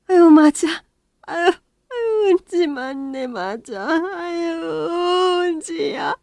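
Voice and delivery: put-on high, slow sobbing words of an old woman; tearful recognition. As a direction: weep as you finally recognise her. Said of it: "부둥켜 안고 한참을 울었습니다".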